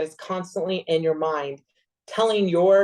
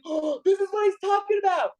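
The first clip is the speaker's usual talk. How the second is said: much higher, excited aha; sudden realization; 13 semitones higher than usual. Retold or imitated. Realizing it in front of her husband, a high excited aha. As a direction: blurt the realization out high and eager. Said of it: "I was like".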